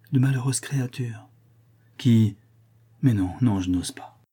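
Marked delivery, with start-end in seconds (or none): 3.0-4.2 muffled behind hands. A narrator's reading. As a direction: speak with face buried in hands. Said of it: "en cachant son visage dans ses mains".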